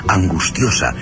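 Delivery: ordinary talk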